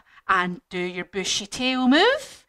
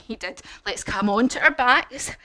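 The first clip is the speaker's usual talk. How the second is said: ordinary delivery, nothing marked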